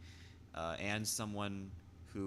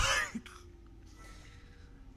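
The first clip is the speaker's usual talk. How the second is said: breathy laugh. Real, not acted. Laugh breaking character.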